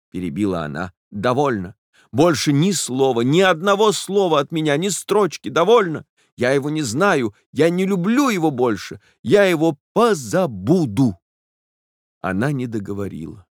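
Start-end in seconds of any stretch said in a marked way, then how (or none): 1.1-11.2 bitter renunciation. A narrator's reading. Jilted girl renouncing her lover, bitter and higher. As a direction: cut it off with hurt pride.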